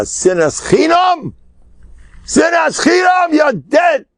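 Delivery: rising to a high shouted doom; verdict shouted. Acted. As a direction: thunder the sentence down at him. Heavenly verdict shouted at soul.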